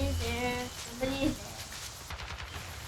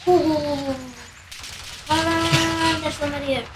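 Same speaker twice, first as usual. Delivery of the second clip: ordinary talk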